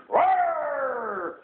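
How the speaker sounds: long high roar; monster roar. Acted. Parent acting out the wild things' terrible roar, high roar.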